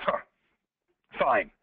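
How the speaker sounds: low, reluctant giving-in after a pause; grudging consent. Retold or imitated. Doubting customer giving in to the plumber, grudging consent.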